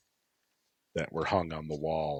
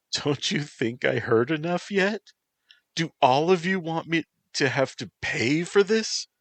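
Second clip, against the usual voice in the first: much higher, louder yelling through tears; tearful yell; 11 semitones higher, 5 dB louder than usual. Acted. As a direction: hurl it at him in tears. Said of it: "she yelled as the tears poured".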